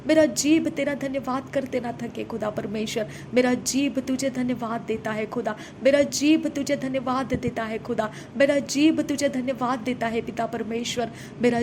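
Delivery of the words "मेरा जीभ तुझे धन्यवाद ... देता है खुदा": ordinary talk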